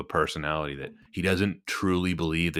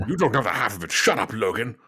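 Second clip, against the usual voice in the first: a touch higher, clipped Stewart voice; Patrick Stewart impression; 4 semitones higher, 3 dB louder than usual. Host imitating Professor X snapping at Logan. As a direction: lightly snap it as Professor X. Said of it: "Then he gets vaporized".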